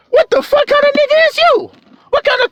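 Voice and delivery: incredulous outburst. Real, not acted.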